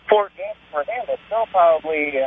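distant voice, away from the mouthpiece; talking off-mic from the phone; phone held away. Real, not acted. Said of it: "Talk into the phone".

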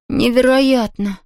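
slow awed gasp; gasping in awe. A narrator's reading. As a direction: breathe out amazement. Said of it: "ахнул Том". (ахнуть от изумления)

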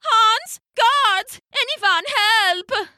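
high, drawn-out calls for help; panicked calling. Acted. Haddo at his door summoning Hans and guards, panicked calling.

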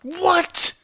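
high, outraged what. Real, not acted. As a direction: shriek it in disbelief. Outraged at low score.

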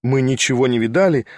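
ordinary delivery, nothing marked

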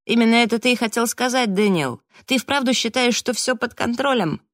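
high-pitched, agitated disbelief; incredulous challenge. A narrator's reading. Raf challenging Daniel's claim of control, high and incredulous.

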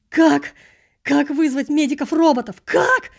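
high, loud frantic questions; panicked cries. Acted. Narrator shouts in panic, unable to call the medics. Panicking over useless phone.

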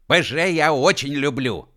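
high, loud declaration; loud proclamation. A narrator's reading. Uef declares love for PZh, loud proclamation. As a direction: proclaim devotion loudly. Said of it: "громко провозгласил У Ф".

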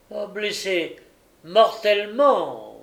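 high, emphatic announcement, slowing on the last word; self-satisfied. A narrator's reading. Gilles answering the young lady, with satisfaction. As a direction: relish the verdict, stretching it out. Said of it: "d'un air de satisfaction".

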